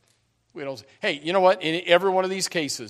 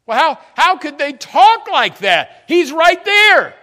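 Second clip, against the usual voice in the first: very high, loud, slow cry of disbelief; incredulous cry; 14 semitones higher, 17 dB louder, slower than usual. Real, not acted. Preacher marvels at the disciples before his congregation, high slow cry. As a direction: stretch it out in amazed exasperation.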